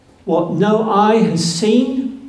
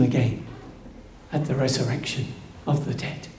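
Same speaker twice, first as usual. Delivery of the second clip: fading to a whisper; 6 semitones lower, 12 dB quieter, much faster than usual. Acted. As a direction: lower and softer, ending in a whisper. Fading hope of resurrection.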